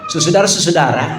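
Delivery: slurred susudara for "Saudara, saudara"; mumbled saudara. Shown as a demonstration. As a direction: mush the word "saudara" together, laughing. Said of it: "Pengucapan tuh harus jelas". ("saudara" digumamkan jadi susudara)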